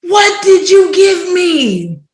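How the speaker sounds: high, slow accusing shout; retold freak-out at full voice; hella loud. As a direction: yell it in acted-out panic, stretching the words. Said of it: "I was hella loud".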